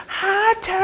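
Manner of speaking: lightly voiced sweet hello; mock shy ex-girlfriend hi; imitating her greeting.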